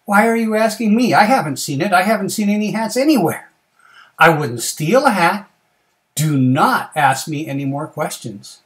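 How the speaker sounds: loud, high, indignant protest; defensive rabbit. Acted. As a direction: protest too much, loud and emphatic.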